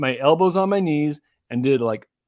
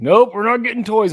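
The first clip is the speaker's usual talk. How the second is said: put-on loud, high firm no to a toddler; loud firm dad no; firm refusal; 9 semitones higher, 8 dB louder than usual. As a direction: shut the toy request down.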